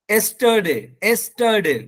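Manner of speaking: "एस्टरडे" starting on E, "es-tuh-day"; no initial Y. Shown as a demonstration. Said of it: "यस्टरडे नहीं होगा". ("एस्टरडे" की शुरुआत ए से)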